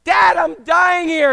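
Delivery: mock desperate kid yelling; kid's slow shout. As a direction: drag out a desperate kid's cry. Kid pleading to dad.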